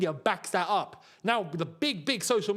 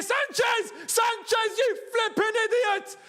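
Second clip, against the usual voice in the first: high, loud yell of frustration; furious shout; 4 dB louder, slower than usual. Real, not acted. Chelsea fan yelling at Sanchez after an error, furious shout. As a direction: scream at the player like a fan who has lost it. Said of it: "Why am I getting emotional?".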